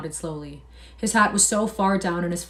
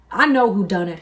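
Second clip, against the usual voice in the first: loud, sudden outburst; crying out; 2 semitones higher, 8 dB louder than usual. A narrator's reading. Curly accusing Lenny before the men, loud. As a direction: burst out with it. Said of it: "he cried".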